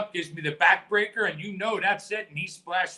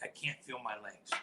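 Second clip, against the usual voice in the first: low, much softer, barely there; faked injury; 6 semitones lower, 14 dB quieter than usual. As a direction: murmur it as if hurt and weak. Faking an injury to the medics.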